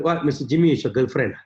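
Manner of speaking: low, lifeless phone pitch; dead-body talk. Acted. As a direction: mumble through it like a drained caller. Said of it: "they talk like a dead body".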